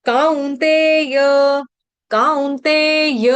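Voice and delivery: कौन्तेय slow with the final य fully sounded; full final ya. Shown as a demonstration. Teacher drilling कौन्तेय with full य. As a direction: say कौन्तेय slowly, landing on the य. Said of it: "य का उच्चारण पूरा आना चाहिए".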